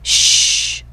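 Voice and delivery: long unvoiced shush; hushing shh. A narrator's reading. Jack silences Annie before the pirates, sharp warning shush. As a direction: hush someone urgently.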